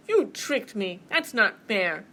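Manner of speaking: loud, indignant bear; flabbergasted. Acted. Bear finding mouse in basket, flabbergasted. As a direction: protest the trick with force. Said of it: "Bear was flabbergasted".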